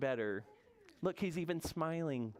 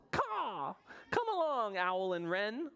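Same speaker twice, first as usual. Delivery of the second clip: high, cawing bird voice; Raven calling; 6 semitones higher, slower than usual. Acted. Raven summons Owl and Wren, high calling voice. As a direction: caw it out high to the flock.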